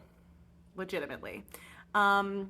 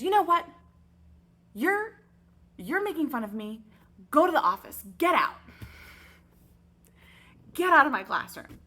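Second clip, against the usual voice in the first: much louder and higher, barking orders; furious yelling teacher; 5 semitones higher, 7 dB louder than usual. Retold or imitated. Teacher yells at Jay to get out of her classroom, furious shout. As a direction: throw him out of the room at full volume.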